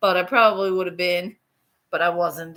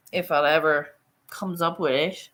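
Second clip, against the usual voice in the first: normal talking voice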